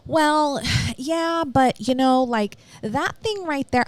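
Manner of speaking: mock nervous hedging with a sigh; nervous backtracking. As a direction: imitate her backpedalling nervously. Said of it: "she's now trying to backtrack".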